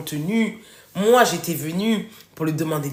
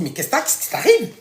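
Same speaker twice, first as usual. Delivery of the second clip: mock alarmed wife; 4 semitones higher than usual. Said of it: "quand notre mari commence à pleurer, on se dit".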